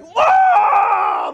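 long high whoop across a beach; big drawn-out shout, falling at the end. Acted. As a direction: holler it loud and stretched out. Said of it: "four or five of us shout".

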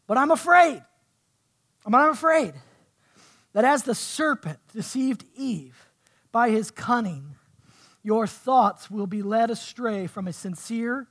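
forceful pulpit reading, broken by pauses; emphatic scripture reading. Acted. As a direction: proclaim the warning to the congregation. Reads scripture with preaching force.

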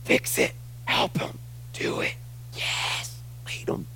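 urgent hushed whisper; whispered intercession. Acted. Spirit praying for you to God, whispering.

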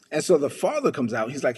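ordinary talk, nothing marked

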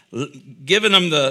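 normal talking voice

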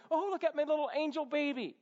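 high, gushing mock baby-adoration; cooing over a baby. Parent gushing over a cute newborn, high mock cooing. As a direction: coo loudly in an exaggerated high voice. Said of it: "so cute and so cuddly".

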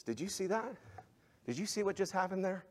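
ordinary talk, nothing marked

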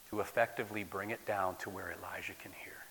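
hushed whispering. Acted. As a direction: bring it down to a whisper. Whispered explanation.

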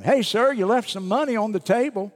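high, loud call across the room; hollering out. Retold or imitated. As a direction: call after him across the restaurant. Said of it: "woman hollered out at him".

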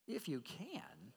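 soft, drawn-out questioning echo; hushed incredulous echo, pitch swooping; rhetorical question. A narrator's reading. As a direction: toss the words back slowly as a quiet challenge. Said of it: "That's a question rhetorical".

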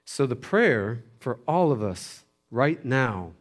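normal talking voice

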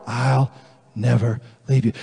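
low, soft, tender promise; God's reassurance. Acted. God's reply to each confession.

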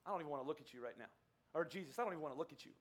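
cold rejection. Retold or imitated. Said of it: "What do you think Peter's reaction would be".

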